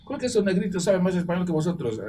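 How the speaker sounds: loud, high, fast question in disbelief; imitating baffled Spanish students; incredulous Spaniards. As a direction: fire it off like shocked classmates. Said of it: "decían los españoles".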